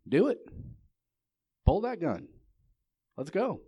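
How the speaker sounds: high, loud, punchy goading; acted dare. Wyatt Earp goads the dealer to draw, daring. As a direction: dare him in separate short bursts.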